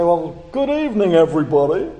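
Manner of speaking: high, loud, smug TV-host greeting; arrogant Max Headroom. Retold or imitated. Preacher mimicking a TV host, high and loud arrogance. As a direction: greet them like a condescending computer anchor. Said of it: "he would always sort of adopt this very arrogant approach".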